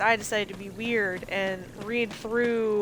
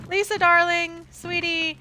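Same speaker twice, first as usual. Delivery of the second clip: mock sweet calling; 8 semitones higher, 3 dB louder, slower than usual.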